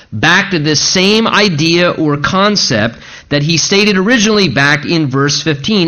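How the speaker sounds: usual speaking voice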